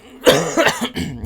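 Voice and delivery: loud chesty cough from a cold. Real, not acted. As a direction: cough hard, sick with a cold. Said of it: "Excuse me for a second".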